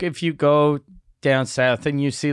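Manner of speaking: usual speaking voice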